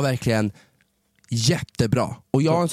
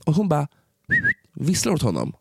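usual speaking voice

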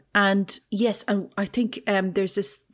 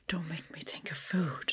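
breathless whispered plea through laughter; giggly whispered don't make me; laughing whisper; 2 semitones lower, 11 dB quieter than usual. Real, not acted. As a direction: beg it while still laughing.

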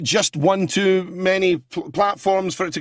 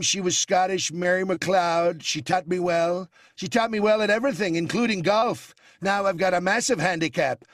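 Donald Trump impression. Impressionist doing Trump chatting to the host, boastful. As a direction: brag like Trump addressing the host. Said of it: "you've got the Donald".

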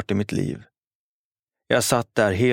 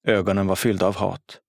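usual speaking voice

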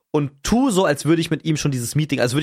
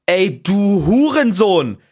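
louder, higher, drawn-out insult; loud and indignant; 4 semitones higher, 5 dB louder, much slower than usual. Retold or imitated. Sleep-deprived man cursing whoever rings, loud and indignant. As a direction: re-enact an outraged shout, slowly stretched. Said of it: "ganz laut und empört".

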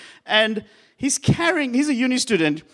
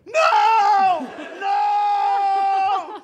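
much higher, frantic shouts of "No!"; panicked yelling; 12 semitones higher than usual. Retold or imitated. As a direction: yell "No!" in naked panic. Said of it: "I just start yelling".

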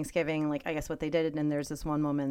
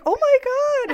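shocked lady; 10 dB louder, faster than usual. Retold or imitated. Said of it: "the lady was like".

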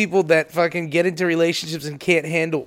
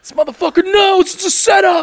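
much higher, shouted alarm; paranoid shout; 13 semitones higher, 4 dB louder than usual. Retold or imitated. Spouse realizing the other listens too, mock panicked shout. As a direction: panic like you've been caught. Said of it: "Wait a minute".

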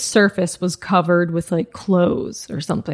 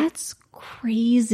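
slow, hushed disbelief; whispered; 5 dB quieter, much slower than usual. Real, not acted. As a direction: breathe it out slowly in shock. Stunned whispered reaction.

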